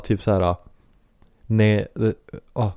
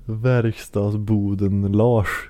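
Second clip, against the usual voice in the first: slow, relishing each syllable; drawn-out name; much slower than usual. Acted. Friend slowly relishing the mascot's full name, drawn out.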